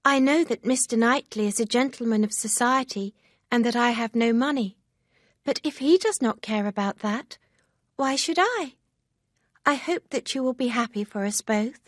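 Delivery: a hint of an eager young friend; Harriet, lightly voiced, eager. A narrator's reading.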